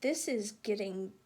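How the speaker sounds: put-on lively read-aloud voice for children; storybook narration. A narrator's reading. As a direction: tell it like a bedtime story. Continues reading the story aloud.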